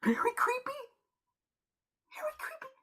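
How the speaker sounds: high, airy whisper; creeped-out whisper. Acted. Friend echoing the hushed woman's creepy word, whispering. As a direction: whisper it in uneasy disbelief.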